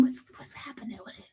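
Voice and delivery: quick, tense whisper. Retold or imitated. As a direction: whisper fast, confused, under your breath.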